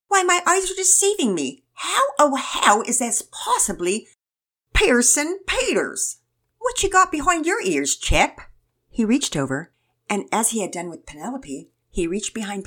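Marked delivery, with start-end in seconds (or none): 0.1-8.5 high, loud, theatrical British voice; hammed-up British uncle, drawing out key words; British accent. A narrator's reading. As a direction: overplay astonishment in a British accent. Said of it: "to a British accent".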